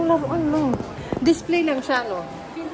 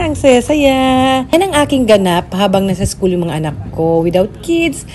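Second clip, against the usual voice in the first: chatty recap to viewers, projected and sing-song; vlog voiceover; 4 semitones lower, 8 dB louder than usual. Real, not acted. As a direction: narrate the day's recap loudly. Recorded vlog narration over footage.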